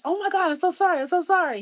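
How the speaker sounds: high-pitched, gushing apology; retold panicked apology, easing slightly on the repeat; flustered apology. Girl realizing she hung up on a jazz great, mortified apology.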